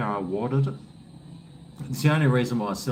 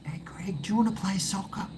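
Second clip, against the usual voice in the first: put-on higher, fast, whispery kid voice; kid neighbour; 9 semitones higher, 5 dB quieter than usual. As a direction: imitate an eager little boy asking to play. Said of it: "he's always coming up and going".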